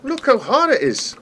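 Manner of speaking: very high strained cry of effort; frustrated cry. Real, not acted. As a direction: yelp as it nearly fails. Nearly dying in the game.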